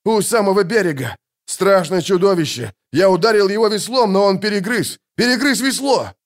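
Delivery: high, excited alarm; high-pitched frantic account; gasped-out report. A narrator's reading. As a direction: blurt it out in horror. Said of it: "выдохнул Локи".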